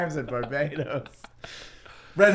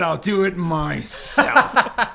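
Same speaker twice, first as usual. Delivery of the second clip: slow grim vow; much slower than usual. Pretend. Booster Gold grim vow.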